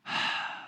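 long breathy exhale; acted sigh of relief; relieved sigh. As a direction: re-enact letting the tension out. Retold relief leaving the store.

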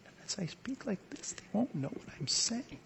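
hushed breathy whisper, barely audible. Shown as a demonstration. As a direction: drop to a secretive whisper so no one hears. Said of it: "I'll just speak so quietly".

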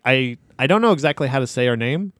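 normal talking voice

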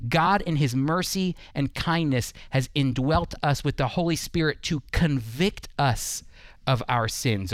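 usual speaking voice

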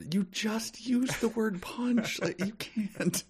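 very high, drawn-out squeal of protest; softly squeaked, slow disbelief; mock exasperation. Writer objecting to a clumsy word choice, mock exasperation.

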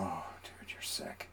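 hushed mock disgust; whispering. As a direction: breathe it out as a secret. Teasing over grape jelly.

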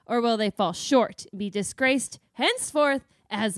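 loud, booming trailer-voice questions; grand announcer. Acted. Cohost introduces character study in a booming voice. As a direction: proclaim it like a ring announcer.

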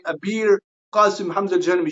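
ordinary talk

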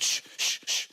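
whispered shh from across the room; shushing. Retold or imitated. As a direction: hush someone, breathy and urgent. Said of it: "She said".

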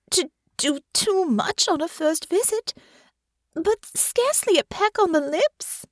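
high, anxious lady's voice, slowing at the end; anxious young lady. A narrator's reading. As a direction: voice Honor as a nervous young noblewoman. Narrator voices hurt Honor.

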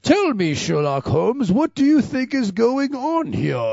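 hammed-up terrible English accent, pitched high; loud, overdone fake English; Geordi's bad English accent. Asking Sherlock Holmes what is going on, terrible English accent. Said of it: "his English accent is fucking terrible".

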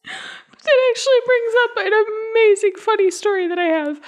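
high, laughing through the words. Real, not acted. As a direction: push through the giggles.

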